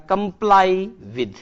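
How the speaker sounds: very slow, each syllable drawn out; slow drilled phrase. Shown as a demonstration. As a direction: spell it out slowly for the class. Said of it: "ये बहुत परीक्षाओं में पूछा जाता है".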